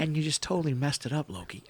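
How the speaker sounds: very soft actor impression, sinking at the end; Owen Wilson. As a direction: let the put-on voice drop away quietly. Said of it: "That's such a good accent".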